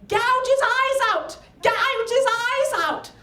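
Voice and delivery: high-pitched frenzied ringside screech; screaming. Retold or imitated. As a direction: scream it like a crazed wrestling fan. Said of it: "this woman was screaming".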